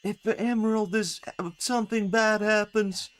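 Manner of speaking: high-pitched mock game-character voice; mock repetitive character. Player mocking a character who repeats one line, high imitation. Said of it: "repeating the same line the whole".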